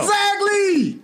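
squeaky high exaggerated exactly; a mocking falsetto with a long, slow stretch; joining in with a high mock voice. Mimicking the rizza voice.

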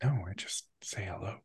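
soft whispered answer, fading away; very quiet, trailing-off aside; hushed reply. Acted. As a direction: whisper back beside an open phone line. Answering her covered-mouthpiece aside.

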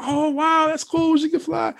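retelling the wife and her friend gushing in a high voice; mock impressed wives. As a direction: imitate wives gasping in delight. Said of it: "her and her friend".

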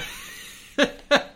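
high breathy laugh; breathless laugh. Real, not acted. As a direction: laugh through the burning. Laughing with mouth on fire.

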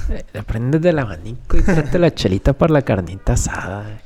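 northern Mexican ranch accent, put-on timbre. Joking about northerners at a barbecue, northern Mexican accent. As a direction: play a laid-back norteño host at a cookout. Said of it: "Los del norte son así".

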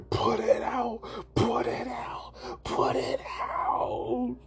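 high, drawn-out panicked cries; imitating a panicked wail; mock panic. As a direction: stretch each cry longer, hammed-up alarm. Acted panic as they burst out.

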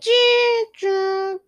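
high squeaky choo choo sound; mock accordion choo. Vlogger mimics an accordion, high squeaky choo.

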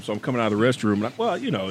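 usual speaking voice

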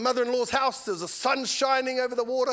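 normal talking voice

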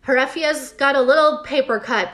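ordinary delivery

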